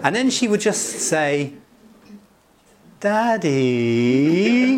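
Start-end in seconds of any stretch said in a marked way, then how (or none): 3.0-4.8 drawn-out pleading Daddy, louder; a daughter at university calling her father for money; daughter's plea. Retold or imitated. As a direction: stretch Daddy like a daughter asking a favour. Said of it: "what she has just said is".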